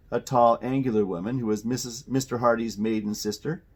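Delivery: ordinary delivery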